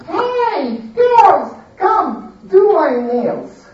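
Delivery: high, loud, bossy pampered lady; spoiled lady. Acted. Lady ordering her maids around, high and demanding. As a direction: order the servant girls about like a spoiled queen.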